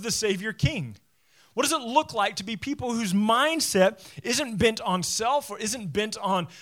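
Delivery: loud, fervent pulpit preaching; preaching at full force. Acted. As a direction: drive the point home to the congregation. Pastor preaching application at full force.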